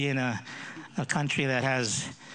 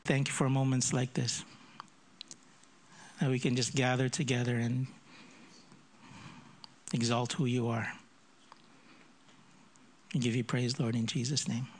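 soft, lower closing prayer; hushed prayer; 3 semitones lower, 3 dB quieter than usual. Acted. Pastor closing prayer in the quiet, hushed. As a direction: pray gently into the silence.

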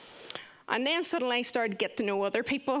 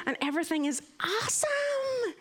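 mock-enthusiastic; 13 semitones higher than usual. Newcomer recalling thoughts on a weird place, mock-enthusiastic. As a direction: stretch and lift "awesome", mocking the positivity. Mocking relentless positivity of the place.

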